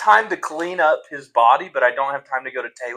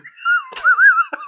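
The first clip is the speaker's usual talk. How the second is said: cackling laugh. Acted. After stabbing the goblin.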